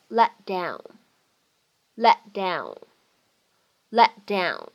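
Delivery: "let down" with a silent stop for the t; unreleased t. Shown as a demonstration. Teacher demonstrating lost plosion, "let down" with unreleased t. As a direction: hold the t of "let" and release into "down". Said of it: "有一个失去爆破".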